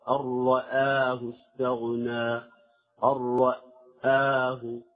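slow recited رآه, thin hamza after heavy ra; measured tajweed model of أن رآه; light hamza demo. Shown as a demonstration. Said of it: "وكذلك إذا كانت مفتوحة قبل مفخم".

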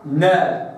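نا with the noon opened on a clean fatha. Shown as a demonstration. Teacher sounding the noon with fatha. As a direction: say نا from the noon's exact point, opened. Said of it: "أتحرك بنون مفتوحة".

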